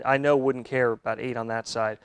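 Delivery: normal talking voice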